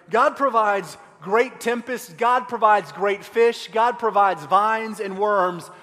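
loud, slowing pulpit build; booming sermon cadence; preaching at full force. Acted. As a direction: drive each line home to the congregation. Preaching, listing God's provisions.

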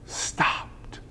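hushed whisper on one word. Shown as a demonstration. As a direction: drop to a whisper for awe. Dramatic hush on key word.

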